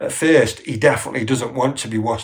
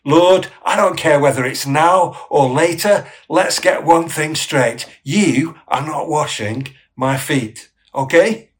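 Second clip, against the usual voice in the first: loud, higher, insistent telling-off; heated rebuke; 4 semitones higher, 6 dB louder than usual. Acted. Peter sets Jesus straight about his feet, heated insistence. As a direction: lay down the law, punching key words. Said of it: "Peter's reply is effectively".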